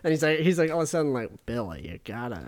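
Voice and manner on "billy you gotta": low, soft mock Southern accent; bad Southern accent. Imitating the film character's accent, bad Southern. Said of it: "such a shitty accent".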